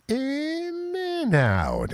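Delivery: way up high and drawn out slowly; a high, slow vocal stretch. Shown as a demonstration. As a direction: stretch the words up into a high sliding squeal. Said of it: "stretch my vocal cords in and".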